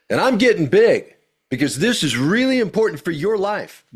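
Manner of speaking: big, high, loud preacher delivery. Shown as a demonstration. Coach demos compelling preacher voice, high and loud. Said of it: "This is where I might be a preacher".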